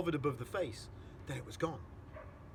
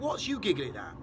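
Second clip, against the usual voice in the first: nettled; 8 dB louder than usual. Acted. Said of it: "slightly nettled".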